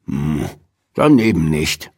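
low, sulky grumble; muttering grumpily. A narrator's reading. Old man snubbed by rabbits, low sulky grumble. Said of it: "murrte Mister Morrison".

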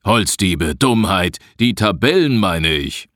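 gruff, dismissive correction; irritated retort. A narrator's reading. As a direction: brush the question off crossly. Forester snaps back at the misunderstanding. (unwirsch abwehrend)

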